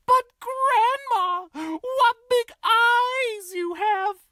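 put-on very high sweet child voice; little girl voice. Red answering at the door, high and sweet. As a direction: chirp like a small girl.

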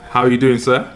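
normal talking voice

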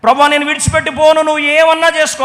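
loud, high defiant plea; desperate cry. Acted. Jacob wrestling with God, loud defiant plea. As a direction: refuse to let go, shouting. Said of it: "కన్నీళ్ళు ఎక్కడ".

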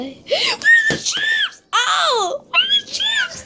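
high wailing shout; desperate shout. Pretend. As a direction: wail for the lost chips. Character frantically searching, crying out.

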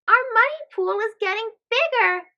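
put-on bright kids' cheer; excited exclaim. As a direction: gloat happily over the growing pot. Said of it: "the kids exclaim".

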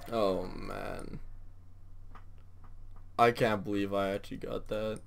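soft, hushed, in shocked awe; stunned murmur. Real, not acted. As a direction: mutter it to yourself, still in disbelief. Stunned after the big win.

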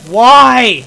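loud high cry of why; loud despairing why; anguished why. Real, not acted. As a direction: howl the question. Game keeps glitching.

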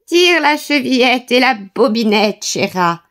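frail granny calling from bed; grandmother answering. A narrator's reading. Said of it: "répondit la grand-mère". (voix de grand-mère faible)

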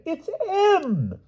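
put-on very high, piping old king voice; fussy old king. A narrator's reading. Pellinore questioning the knights, high and piping. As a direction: pipe it like a dotty old monarch. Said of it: "said the king".

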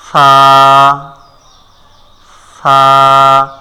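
drawn-out, steady সা; model letter pronunciation. Shown as a demonstration. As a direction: hold সা long on one low, level note. Teacher models the Arabic letter.

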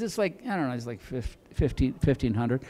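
imitating a wife mumbling the price; very soft, halting, dropping low; reluctant admission.

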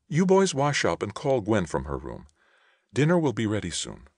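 lightly acted mother, high onset falling away; mother giving orders. A narrator's reading. Mother figure calls the boys to dinner.

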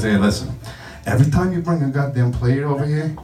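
quiet menacing orders from a wiseguy; Tony Soprano. Retold or imitated. Buffet guest pulling waiter aside, low mobster voice. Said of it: "now I got Tony Soprano on my ass".

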